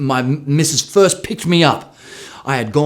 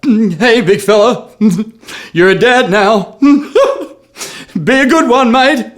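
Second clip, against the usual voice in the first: higher, louder, slow words broken by laughs; laughing through tears; 10 semitones higher, 5 dB louder, slower than usual. Retold or imitated. New father films his newborn son, laughing and emotional. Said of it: "I was like".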